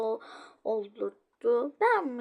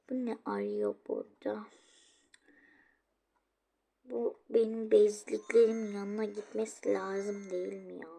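ordinary talk, nothing marked

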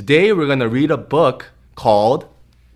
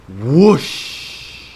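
whooshing wind. Acted. Sails catching wind.

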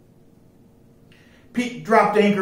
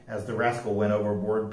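plain reading voice

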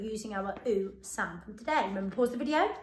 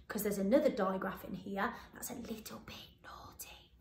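whisper; 2 semitones lower, 8 dB quieter than usual. Acted. Teacher confides a tricky digraph.

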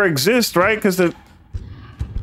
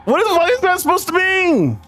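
baffled; 12 semitones higher than usual. Real, not acted. Confused reaction to game.

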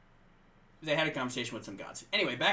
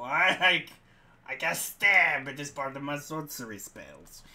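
dim sword mage; 7 dB louder than usual. Acted. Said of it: "I'm gonna play a character that speaks like that".

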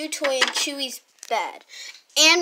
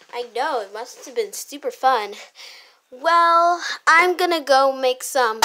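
ordinary delivery

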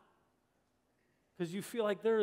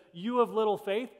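ordinary talk

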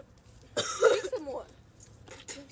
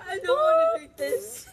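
whiny protest; 8 semitones higher, 5 dB louder than usual. Real, not acted. Refusing the gross drink.

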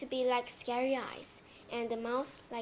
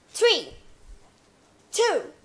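loud, rising countdown; 7 semitones higher, 14 dB louder, slower than usual. Acted. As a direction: build up to the reveal. Countdown to surprise.

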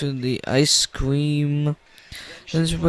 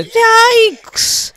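very high, loud comic alarm; startled yelp; 7 dB louder than usual. Acted. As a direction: yelp in horror at the sight. Father startled again.

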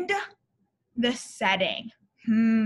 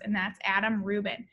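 ordinary delivery, nothing marked

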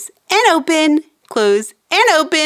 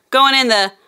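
ordinary talk

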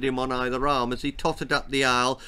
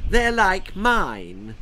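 slow, higher squawk; squawking aunt; 6 semitones higher, 4 dB louder, much slower than usual. A narrator's reading. Aunt insisting to her sister, slow squawk. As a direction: drag it out possessively. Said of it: "she squawked".

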